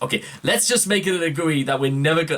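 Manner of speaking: usual speaking voice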